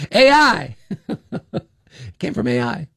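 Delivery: laughing. Real, not acted. Host joking about his accent slip, laughing. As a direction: crack up at your own joke.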